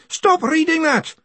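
high, outraged shout. A narrator's reading. Greenyham tries to halt the reading, high shout. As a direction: shout the order, pitch leaping up. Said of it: "Greenyham shouted".

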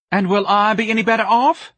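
high, loud wail; distraught wife. A narrator's reading. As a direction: wail in self-pity. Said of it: "aquamarine lids were trembling".

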